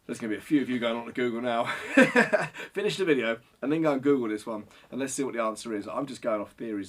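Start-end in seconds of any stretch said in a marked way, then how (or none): 1.7-2.7 high, loud burst of laughter. Real, not acted. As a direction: laugh out loud at your own remark. Amused by viewers going to Google.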